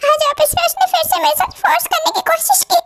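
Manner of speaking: tearful, high breaking voice; weeping while telling; crying. Acted. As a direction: sob out what happened. Victim telling through tears.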